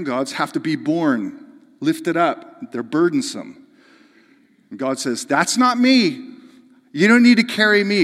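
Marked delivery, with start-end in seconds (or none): 5.2-8.0 ringing pulpit declaration; loud, emphatic. Acted. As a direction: proclaim it as God's denial. Preacher voicing God's reply.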